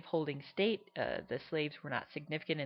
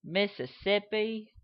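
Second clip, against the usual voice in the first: generic Southern accent; 6 semitones higher, 3 dB louder, slower than usual. Shown as a demonstration. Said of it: "more of a generic Southern accent".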